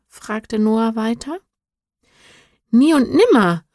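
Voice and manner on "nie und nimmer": higher, louder horrified exclamation; horrified cry. Acted. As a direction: cry out in shock and refusal. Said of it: "rief Henri entsetzt".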